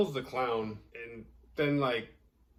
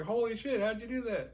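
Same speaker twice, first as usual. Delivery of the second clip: high astonished; 13 semitones higher than usual. Retold or imitated. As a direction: gasp out the question. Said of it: "They're like".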